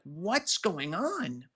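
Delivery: imitating his own rattled phone-agent voice; alarmed concern. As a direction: sound thrown by the scream and checking on him. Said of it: "I'm like".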